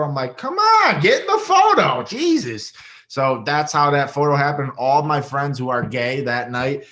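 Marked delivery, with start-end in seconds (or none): 0.4-2.7 much higher and louder, laughing as you call her in; laughing exclamation. Retold or imitated. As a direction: shout it, half laughing, delighted. Inviting [name] into the photo.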